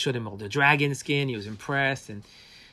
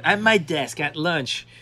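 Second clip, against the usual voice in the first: usual speaking voice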